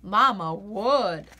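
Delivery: usual speaking voice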